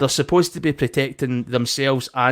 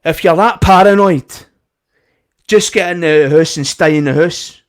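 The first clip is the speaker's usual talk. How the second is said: louder scolding of a customer; mock angry staff member; 3 semitones higher, 5 dB louder than usual. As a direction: tell him off sharply. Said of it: "she's getting it mental".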